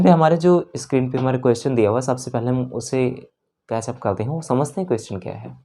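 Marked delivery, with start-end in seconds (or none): none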